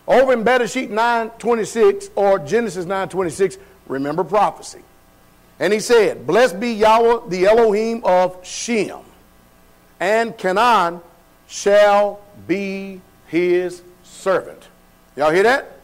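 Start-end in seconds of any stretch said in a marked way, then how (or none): 5.6-14.7 slow, deliberate scripture reading; drawn-out, weighty recitation. A narrator's reading. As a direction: read the verse out slowly, phrase by phrase.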